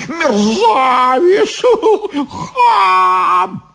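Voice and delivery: very high, stretched witch screech; yelling insults. A narrator's reading. Stamping Baba Yaga abuses Ivan, screeching. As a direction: fling each insult high and long. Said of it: "заорала Яга и затопала ногами".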